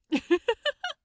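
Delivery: bright high giggle; light laugh. A narrator's reading. Aziraphale laughing at Crowley's eloquent answer, bright giggle. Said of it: "Aziraphale laughed".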